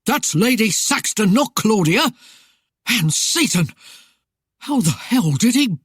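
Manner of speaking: alarmed, outraged bellow. A narrator's reading. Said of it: "he bellowed".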